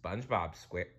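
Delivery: usual speaking voice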